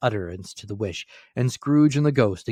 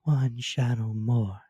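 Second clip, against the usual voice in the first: slow, otherworldly ghost voice; the ghost insists to Scrooge, slowly; ghost exclaiming; 2 semitones higher, slower than usual. A narrator's reading. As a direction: say it slowly like a spirit. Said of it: "exclaimed the ghost".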